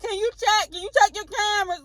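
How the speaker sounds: high pushy imitation of the man; hammed-up demanding stranger; mock demanding voice. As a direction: pester like the rude customer.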